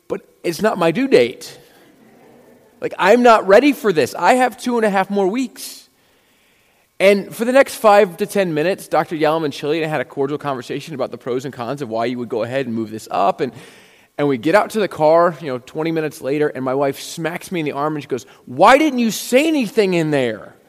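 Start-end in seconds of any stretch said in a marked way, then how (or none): 0.1-5.8 higher, louder flustered pleading; panicked protest. Retold or imitated. Wife protesting the early delivery to the doctor, higher and louder. 18.5-20.5 higher, louder accusing wife; exasperated scolding. Retold or imitated. Wife scolding her husband in the car, higher and louder.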